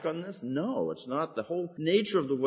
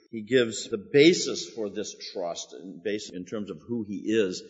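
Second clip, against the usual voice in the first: ordinary talk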